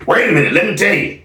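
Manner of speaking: ordinary talk, nothing marked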